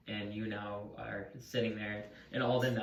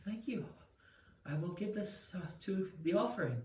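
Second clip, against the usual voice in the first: lightly voiced priestess; 8 semitones higher, 3 dB quieter than usual.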